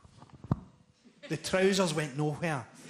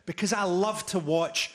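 ordinary talk

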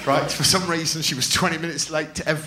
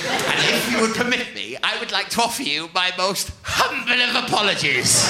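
higher, grandly formal housewife voice; Karen Powell; 5 semitones higher than usual. Acted. Pupil apologising to her teacher, prim and overwrought. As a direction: swell into an over-humble apology. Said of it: "This is how she spoke".

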